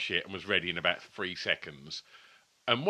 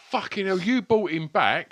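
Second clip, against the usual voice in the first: angry; 10 semitones higher, 6 dB louder than usual. Retold or imitated. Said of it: "just going like".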